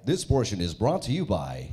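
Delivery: deep broadcast sponsor tag; announcer voice. Shown as a demonstration. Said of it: "I'm the guy that says stuff like".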